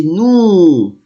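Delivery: "nous" held long, ending on the vowel; silent final S. Shown as a demonstration. Teacher repeats the pronoun, "nous" without its S. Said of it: "S, I will not pronounce it".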